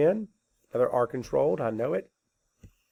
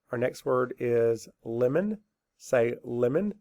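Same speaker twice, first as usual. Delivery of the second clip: normal talking voice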